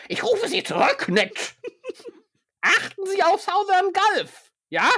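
mock yell at a phone partner; bellowing broker. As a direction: bark orders down the line. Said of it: "und brüllte".

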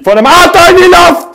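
high loud shrieking; panicked yell. Real, not acted. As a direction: shriek while flying through the air. Gamer yelling as he gets blown up.

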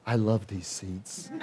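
slow, soft and dreamy; blissful. Retold or imitated. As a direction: savor each word, soft and slow. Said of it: "That's all I kept saying".

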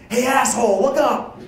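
loud, high-pitched yell of alarm; screaming buddy. Retold or imitated. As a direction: yell it like warning off a careless cyclist at full volume. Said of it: "screams at the lo-, top of his lungs".

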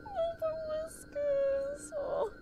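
high, slow cooing at a pet. Real, not acted. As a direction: sing the name out like to a kitten. Calling the pet again.

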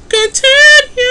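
"Continue" jumped way up into a high squeak; squeaky high continue. Shown as a demonstration. Voicing his Sims character's squeaky command. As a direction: repeat "Continue" in a squeaky falsetto.

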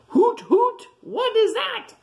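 very high hooting owl voice. A narrator's reading. Owl asks about the snoring, high hooting voice. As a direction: hoot and ask in a loud falsetto. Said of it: "says the owl".

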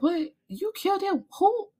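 mock gasping surprise; shocked disbelief. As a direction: Lyle learning of the killing, high and shocked. Lyle hears of killing.

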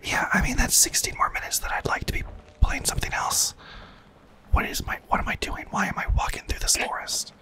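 hushed game whisper; leaning in to whisper. Real, not acted. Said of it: "get my whisper voice on".

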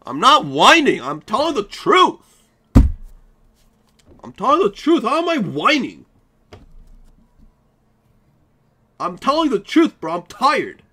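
high, loud defensive protest; indignant protest. Real, not acted. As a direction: insist loudly on telling the truth. Defends himself against whining accusation.

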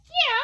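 long high-pitched yell; mock-rebellious shriek; defiant playful scream. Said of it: "I'm gonna be screaming at home".

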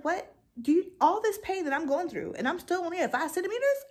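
high, loud, fast disbelieving protest; shrill it out like the pain was for nothing; heated outrage. Retold or imitated. Telling the nurses she's still at five, outraged and high. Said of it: "I was mad. I was, I was heated".

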